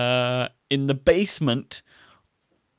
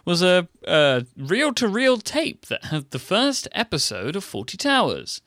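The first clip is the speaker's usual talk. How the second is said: news reader voice; 5 semitones higher than usual. Retold or imitated. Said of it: "when on the news you see".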